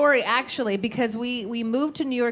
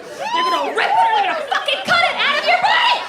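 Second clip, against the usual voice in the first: much higher, louder, hammed-up war yell; mock battle cry; 5 dB louder than usual. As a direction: yell it like a soldier charging. Retelling the thought after childbirth.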